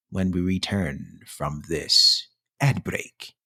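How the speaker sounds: announcer. Acted. Announcing ad break.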